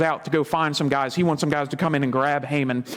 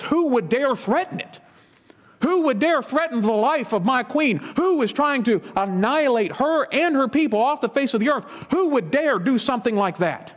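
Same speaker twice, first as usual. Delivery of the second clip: a higher-pitched, outraged king demanding answers; 7 semitones higher than usual. Acted. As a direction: voice the king's disbelieving outrage.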